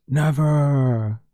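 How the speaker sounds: drawn-out teasing Never; mock solemn never. Shown as a demonstration. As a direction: stretch out Never. with a wink. Sarcastic repeated denial.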